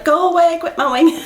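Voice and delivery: high, half-laughing call; mock impatient plea. Vlogger shooing the noisy gardener, mock impatient. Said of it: "I've been like".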